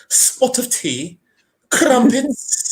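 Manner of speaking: high, drawn-out mock English voice; hammed-up English tea-time accent. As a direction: play a cartoon English toff. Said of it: "Like the English".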